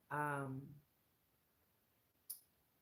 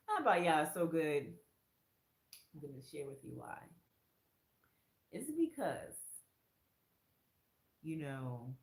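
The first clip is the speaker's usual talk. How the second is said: ordinary delivery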